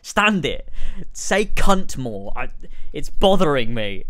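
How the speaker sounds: loud, slow exasperated yelling; angry rant. Real, not acted. Ranting to a friend about brand memes, loud and furious. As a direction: blow up at how cringy it is.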